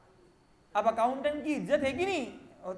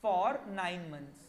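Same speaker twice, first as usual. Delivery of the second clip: very slow, measured words with pauses; slow dictation; 5 semitones lower, much slower than usual. Real, not acted. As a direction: dictate it word by word as you write. Dictating while writing on board. (लिखते हुए बहुत धीरे-धीरे बोलना)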